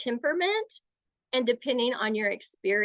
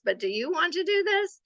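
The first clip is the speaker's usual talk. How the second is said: higher-pitched question with weight on "do"; passive asking; 8 semitones higher than usual. Shown as a demonstration. Adult backing off to a child, passive questioning tone. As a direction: undercut the order by asking. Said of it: "it's giving a mixed message".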